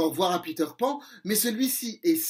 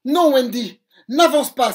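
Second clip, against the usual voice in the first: cri aigu et bien plus fort; cri d'alarme; 6 semitones higher, 11 dB louder than usual. Acted. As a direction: crier pour arrêter Wendy. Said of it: "s'écrie-t-il". (Peter crie à Wendy de s'arrêter)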